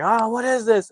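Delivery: high startled cry of disbelief; shocked gasp. Retold or imitated. Couple caught off guard at the monument, high startled cry.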